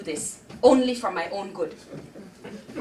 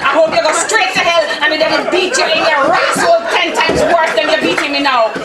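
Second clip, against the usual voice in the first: loud, higher rage hurled at the aunt; furious cursing; 7 semitones higher, 12 dB louder than usual. Acted. Girl rages at Aunt June in her head, loud curses. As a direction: spit out every curse you can find.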